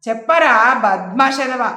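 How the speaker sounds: loud, slow angry command; raging demand. A narrator's reading. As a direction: bark it at the son to make him talk. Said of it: "గంతులేశాడు పర్వతాలరావు".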